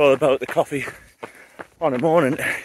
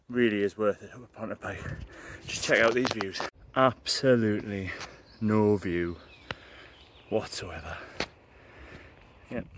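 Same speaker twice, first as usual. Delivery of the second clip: deadpan sarcasm; 6 semitones lower, 8 dB quieter than usual. Real, not acted. Mocking the missing view.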